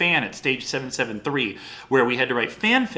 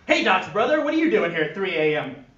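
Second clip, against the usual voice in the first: Bulldog voice; 7 semitones higher than usual. Acted.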